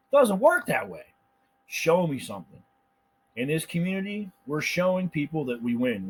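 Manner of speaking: usual speaking voice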